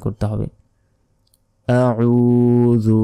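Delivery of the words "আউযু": আউযু with the first vowel drawn one count; recited আউযু with elongation; one alif stretch. Shown as a demonstration. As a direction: lengthen the vowel in আউযু slowly. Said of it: "জিহ্বা বের করে উচ্চারণ".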